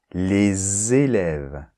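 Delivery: very slow, les élèves with a clear z linking; teacher drawing out the example; slow liaison demo. Shown as a demonstration. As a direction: stretch it out to show the liaison. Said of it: "entre un déterminant et un substantif".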